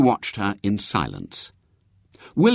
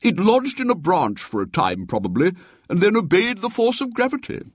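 high, chuckling pompous cleric; amused bishop; 9 semitones higher than usual. A narrator's reading. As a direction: explain it with a chuckle. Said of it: "said the bishop".

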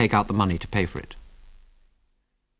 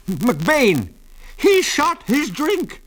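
high, drawn-out shrill holler; shrill shout; 4 dB louder, much slower than usual. A narrator's reading. Old man accuses the gunman to the constable, shrill shout. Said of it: "shouted old Mose shrilly".

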